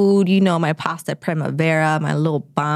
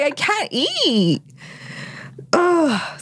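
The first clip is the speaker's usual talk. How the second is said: high, slow mock whine; exaggerated, drawn-out grievance; put-out complaint; 9 semitones higher, much slower than usual. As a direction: stretch it out in hammed-up dismay. Acted upset over spoiled meal.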